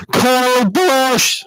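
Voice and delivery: high, drawn-out scream right on the microphone; kid screaming into mic. Retold or imitated. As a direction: scream the full name, mouth on the mic. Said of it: "literally eats it like what I'm doing".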